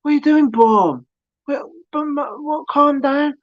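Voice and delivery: high-pitched appeal to calm down; retold bewildered plea. Acting out pleading with attackers.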